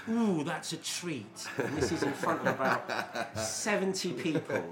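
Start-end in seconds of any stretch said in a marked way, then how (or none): 0.1-1.5 high, very slow mock relish; delighted punchline. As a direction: draw out the ooh with put-on pleasure. Joke character's delighted punchline.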